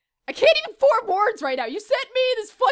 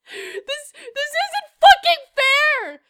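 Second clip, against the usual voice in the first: high, drawn-out outraged whine; mock anger; 6 semitones higher, slower than usual. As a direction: cry out slowly at the injustice. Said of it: "I'm so fucking angry".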